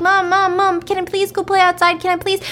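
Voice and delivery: high, whiny mock-child pleading; begging kid. Kids begging mom to go play outside, pleading. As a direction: pester mom like an impatient child. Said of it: "her kids are like".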